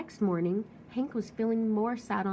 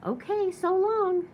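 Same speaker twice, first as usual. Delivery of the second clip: high, slow, sunny farewell; cheerful goodbye; 10 semitones higher, 5 dB louder than usual. Acted. Tumbleweed cheerfully waving off the grumpy cactus. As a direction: sing out a breezy goodbye. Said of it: "said Rosie cheerfully".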